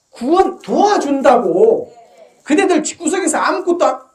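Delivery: monk scolding his listeners, loud preaching; fiery preaching. Acted. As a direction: drive it home to the congregation. Preaching at full force.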